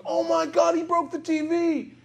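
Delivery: very high-pitched mock squeal of kids; freaking out. Two boys seeing the TV broken, freaking out. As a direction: imitate panicked children in a high, strained voice. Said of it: "And they're freaking out".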